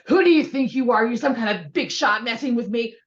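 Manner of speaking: loud, high, indignant; acted furious confrontation; mock angry outburst. Shown as a demonstration. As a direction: go off on them like you were bumped. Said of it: "I go off on them".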